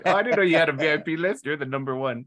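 mock gushing. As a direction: play a starstruck fan, hammed up. Mocking an imagined person.

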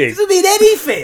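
high, loud exasperation; exasperated. Real, not acted. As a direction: squeal in disbelief. Exasperated at caption.

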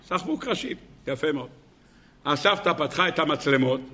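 ordinary delivery

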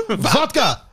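yelled again with a laugh in it; mock yell. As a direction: shout Vodka! as a playful demand. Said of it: "he just yelled it".

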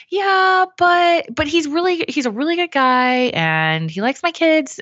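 high-pitched, pleading excuse-making; out-of-alignment voice. Shown as a demonstration. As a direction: talk yourself into it, voice way up. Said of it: "the voice you don't wanna hear".

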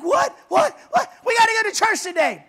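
high, loud, flustered and fast; jolted awake and confused; startled. Retold or imitated. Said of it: "And he's like".

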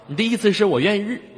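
usual speaking voice

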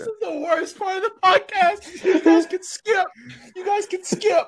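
giggly. Real, not acted. Host telling listeners to skip, giggling. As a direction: laugh while telling them to skip.

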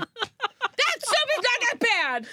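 high, mock-angry scolding; angry mom. Mom scolds her son for throwing up, high and mock-angry. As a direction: berate the kid up high. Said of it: "I said".

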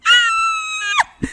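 very high, loud fangirl scream; freaked-out scream. Retold or imitated. Fan sees Bianca coming over, shrill scream. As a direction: shriek at the sight of a star. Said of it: "I'm, like, freaking out".

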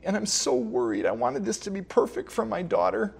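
high-pitched, pleading retelling of a distraught father; imitated tearful distress. Upset father confiding in the pastor at the wedding, imitated tearful distress. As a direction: pitch it up as if near tears, without shouting. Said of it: "and crying".

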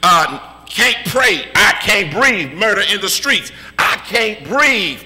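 preacher working up to an impassioned peak; building preaching. Real, not acted. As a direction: start restrained, climb in pitch and intensity with each refrain. Sermon litany building to shout.

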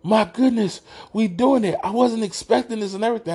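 high-pitched, thrilled coworker; imitated elation. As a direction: replay his delighted disbelief with pitch way up. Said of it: "like, elated".

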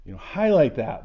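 usual speaking voice

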